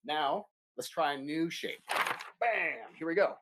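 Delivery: ordinary talk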